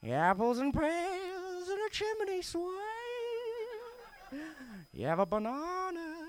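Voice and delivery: very slow Cockney swooping up high; hammed-up Cockney, stretching the last word into a long high wail; clueless imitation of a Cockney; terrible Cockney. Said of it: "You need to dial up the Cockney".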